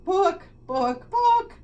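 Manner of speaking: high, loud "Book, book, book" rising; clear clucking. Acted. Hen making herself understood, clear clucks. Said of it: "clucked the chicken quite clearly".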